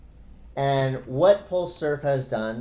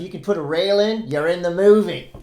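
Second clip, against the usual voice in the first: put-on louder Australian accent, rising to a punch; loud mock Aussie accent; 4 semitones higher, 8 dB louder than usual. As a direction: belt the quote in a hammed-up Australian accent. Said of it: "in an Australian accent".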